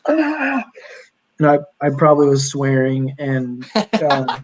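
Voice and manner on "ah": high mock yelp after hitting head; retold yelp of pain. Knocked down by the dryer door, mock pained yelp. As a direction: replay the cry from the fall, half-hammed. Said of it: "fell down on the ground and was like".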